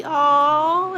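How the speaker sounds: high, drawn-out "aww"; moved, pitch lifting on a long oh; touched aww. Real, not acted. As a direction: melt at a kind compliment. Touched by a viewer's kind message.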